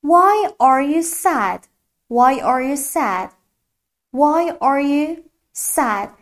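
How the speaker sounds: "Why are you sad?" very slowly; slow model question. Shown as a demonstration. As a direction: drill it slowly for the class. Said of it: "یعنی چرا".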